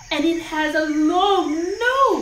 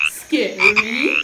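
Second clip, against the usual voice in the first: scary voice; 6 semitones lower, 4 dB louder than usual. Shown as a demonstration. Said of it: "Number one".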